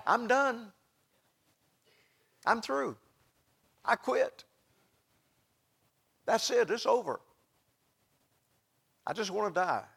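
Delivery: soft, weary voicing of a man who quits; Elijah crying out. Elijah in the valley giving up, softly despairing. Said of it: "you hear him crying out".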